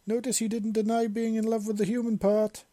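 high, loud teasing call across the yard; calling back. Acted. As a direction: call it out to tease her. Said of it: "Ven called back".

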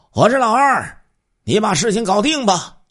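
high, loud call through the door; shouted signal. Acted. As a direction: robber shouts the code word to his partner. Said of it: "喊了一句约定好的暗号". (高声喊出暗号)

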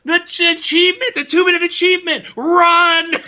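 high, loud, rushed urging, breaking into a laugh; urgent shout at a teammate; frantic yell. Retold or imitated. Gamer yelling at teammate to run for the achievement, frantic. Yelling orders mid-game.

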